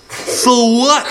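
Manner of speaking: put-on high, cheeky sneer of a rude girl; rude girl retort. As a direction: toss it back like a bratty kid.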